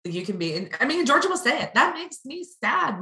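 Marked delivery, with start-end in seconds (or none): 1.7-3.0 put-on high, slow little-girl voice; toddler voice. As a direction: say it like a sad two-year-old. Quoting toddler daughter.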